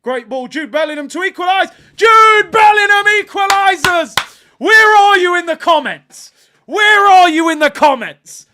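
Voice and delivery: roaring high and very loud; ecstatic goal shout. Real, not acted. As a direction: scream the equaliser to your viewers, elated. Live reaction to equalising goal.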